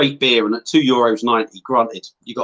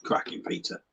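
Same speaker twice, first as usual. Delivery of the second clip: breathy whispered aside; whispering; 14 dB quieter than usual. Real, not acted. Aside, unvoiced.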